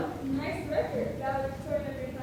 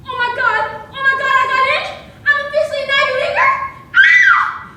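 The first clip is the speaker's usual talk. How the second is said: screaming with joy, much louder and higher; overjoyed yell after an acceptance call; ecstatic shout; 9 semitones higher, 17 dB louder than usual. Acted. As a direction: shriek the good news down the phone. Learns she got into Ivy.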